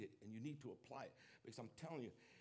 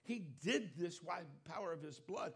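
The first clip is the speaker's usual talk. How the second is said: loud, higher preaching voice, pressing each phrase; full-force preaching; 4 semitones higher, 6 dB louder than usual. Acted. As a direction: preach it out to the congregation with fervor.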